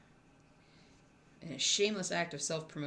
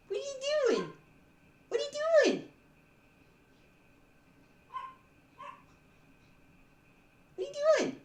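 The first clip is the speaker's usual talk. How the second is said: much higher, louder pet voice; excited dog talk; 12 semitones higher, 5 dB louder than usual. Real, not acted. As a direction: squeal at the dog as she runs around. Dog runs around excitedly.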